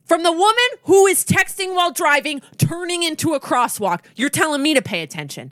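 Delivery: loud, high opening, easing as it goes; acted-out incredulous rant; indignant retort. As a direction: fire back in outraged disbelief. Retold crosswalk quarrel with driver.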